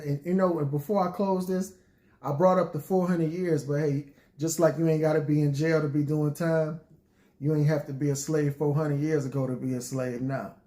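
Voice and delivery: ordinary delivery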